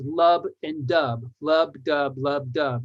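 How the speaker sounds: lub dub with the stress on dub; heartbeat rhythm. Shown as a demonstration. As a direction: beat it out like a heartbeat, unstressed then stressed. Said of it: "And I am is like that".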